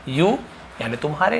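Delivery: ordinary talk, nothing marked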